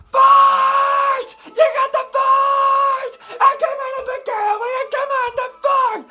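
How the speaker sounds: high, loud cheering shout; excited shouting. Real, not acted. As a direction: yell it like a fan. Cheering ending.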